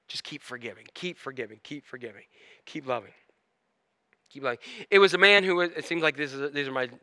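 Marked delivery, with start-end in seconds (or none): 0.1-4.6 very soft, low repetition; hushed, low refrain with space between phrases; gentle urging. Real, not acted. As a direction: drop right down and repeat it tenderly. Gentle repeated urging to forgive.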